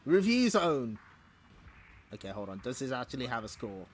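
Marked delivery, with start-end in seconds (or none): 0.0-1.0 announcer call. Acted. Announcing the comic's review section.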